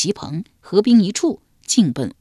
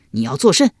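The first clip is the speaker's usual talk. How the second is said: higher and louder, caught off guard; startled question; 7 semitones higher, 4 dB louder, faster than usual. A narrator's reading. Pinned general asks his attacker, alarmed. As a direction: ask in sudden alarm as you're thrown down. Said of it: "高黑惊问道".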